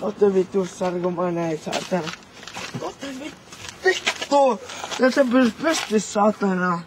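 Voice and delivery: thick slurred words, muttered curses. Real, not acted. Drunk man slurring curses at his friends.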